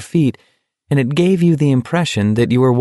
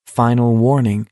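ordinary delivery, nothing marked

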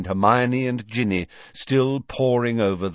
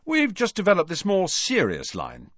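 high-pitched, chatty twin salesman; shop-owner twin Fred, businesslike; 10 semitones higher than usual. A narrator's reading.

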